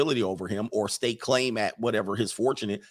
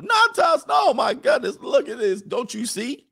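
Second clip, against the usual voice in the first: much higher, shouting the name; alarmed mother; 12 semitones higher, 4 dB louder than usual. Retold or imitated. Mother shouting at the Natas poster, alarmed high yell. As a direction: shriek it out in holy alarm.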